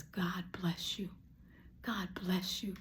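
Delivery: whispered blessing. Retold or imitated. Whispering a blessing over the kind lady. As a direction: murmur a blessing in awe. Grateful blessing under her breath.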